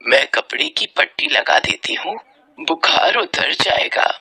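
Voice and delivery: low, breathy comfort; hushed motherly reassurance; reassuring mother. Acted. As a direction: soothe a sick child, slowing at the end. Mother reassures feverish son.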